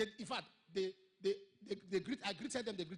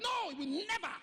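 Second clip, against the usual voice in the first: angry refusal; 13 semitones higher, 9 dB louder than usual. Retold or imitated. The dead man's refusal.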